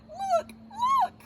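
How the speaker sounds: very high, tiny squeal; Baby Bear. Acted. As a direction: squeak it like a wee cub. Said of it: "he cried in his wee little".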